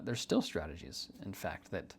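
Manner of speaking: ordinary delivery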